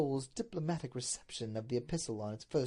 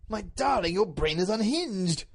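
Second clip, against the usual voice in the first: louder, raised-pitch exclamation; appalled husband's outburst; alarmed Duke; 5 semitones higher, 6 dB louder than usual. Acted. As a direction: cry out in shocked dismay. Duke alarmed at wife's question.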